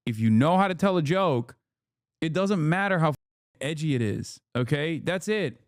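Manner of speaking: ordinary talk, nothing marked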